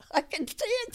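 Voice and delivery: real laugh breaking through the words; can't help laughing as she pictures it. Real, not acted. Old woman laughing at the memory of the prank.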